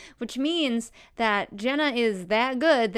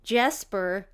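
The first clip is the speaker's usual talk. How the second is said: ordinary talk, nothing marked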